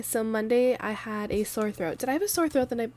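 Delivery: usual speaking voice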